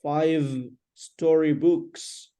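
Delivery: slow, separated FIVE, STORYBOOKS; slow modelling. Shown as a demonstration. Teacher models the phrase for a student, slowly. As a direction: stretch out each word for a learner. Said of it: "Say slowly".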